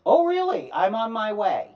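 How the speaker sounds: loud, high, determined; indignant reply. Retold or imitated. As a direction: declare you're coming now. Indignant resolve on phone.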